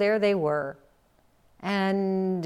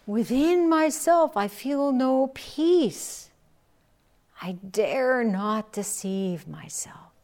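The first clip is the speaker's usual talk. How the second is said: sincere confession; 5 semitones higher, 4 dB louder, slower than usual. Acted. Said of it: "And he said".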